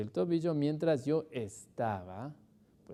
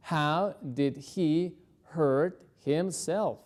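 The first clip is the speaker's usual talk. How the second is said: slow spaced repeat; much slower than usual. Shown as a demonstration. Teacher repeats the question slowly, split in two. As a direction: pause after "he", then "hurt himself" slowly. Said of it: "slow repeat for learners".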